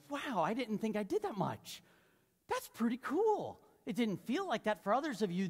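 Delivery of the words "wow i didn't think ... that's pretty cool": voicing a pleasantly amazed listener, pitched up; wow, higher and impressed, stretching the last words; encouraged amazement. Congregant impressed by their own reading progress, encouraged amazement. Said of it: "this is maybe really encouraging to go".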